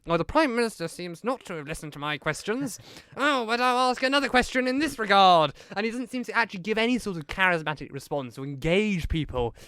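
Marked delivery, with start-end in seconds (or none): 0.1-5.5 higher, prim mock-polite Keir; a hint of a weary opposition leader; Keir impression. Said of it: "Keir comes back, almost either saying".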